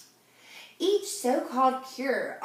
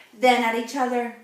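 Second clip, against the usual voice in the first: plain reading voice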